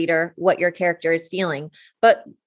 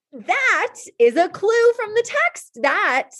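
high-pitched, emphatic classroom delight; thrilled teacher voice, much higher; excited teacher praise; 13 semitones higher than usual. Retold or imitated. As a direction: celebrate the students' find, pitched way up. Teacher acts praising students' clue sentences.

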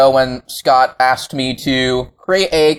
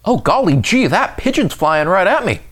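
put-on wholesome 1950s kid; 10 semitones higher than usual.

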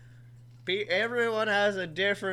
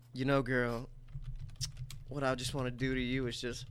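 deep seducer voice; low sleazy come-on; 8 semitones lower, 9 dB quieter, faster than usual. Acted. To his cohost, a low hammed-up seducer voice.

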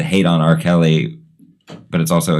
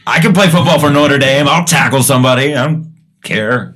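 pumped-up jock; 8 semitones higher, 5 dB louder than usual. Retold or imitated. Mocking a pumped-up football player.

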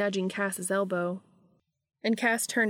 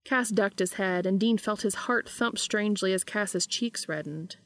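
plain reading voice

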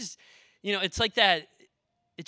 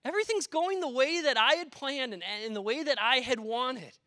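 high, sing-song voice of easy faith; mock the comfortable believer, pitched up; smug fair-weather believer; 6 semitones higher than usual. Preacher mocking complacent churchgoers, high sing-song. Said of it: "it is easy to say".

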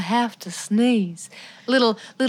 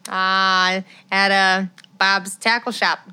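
put-on Midwest accent; 2 semitones lower than usual. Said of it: "My accent's evolving".

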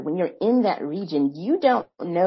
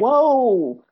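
louder, higher drawn-in listener reaction; retold amazement; 6 semitones higher, 7 dB louder than usual. Clubhouse listeners stopping her mid-sentence, retold amazement. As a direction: imitate an impressed listener cutting in.

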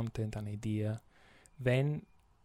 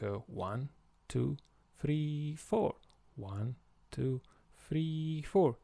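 teacher counting four four time; counting the beat; 3 semitones higher, slower than usual. Shown as a demonstration. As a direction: count one two three four with a lift on four. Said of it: "we think".

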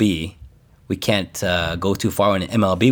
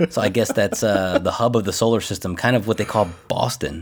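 ordinary talk, nothing marked

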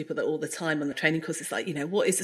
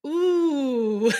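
high drawn-out mock ooh of sympathy; a hammed-up concerned ooh; 7 semitones higher than usual. Therapist reacting, mock sympathy.

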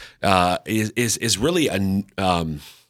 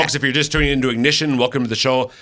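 radio host intro; 4 semitones higher, much faster than usual. Real, not acted. Radio station announcement.

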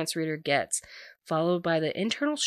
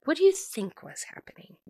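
1 exasperated Monica; 4 semitones higher than usual. Retold or imitated. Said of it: "And Monica's like".

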